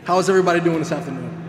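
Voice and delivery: nervous laugh, then fast and loud; laughing off the nerves, brisk and loud. Real, not acted. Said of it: "I'm a little nervous".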